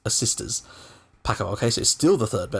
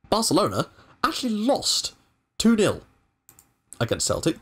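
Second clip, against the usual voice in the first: excited; 11 semitones higher than usual. Real, not acted. Rival loses, his team wins group.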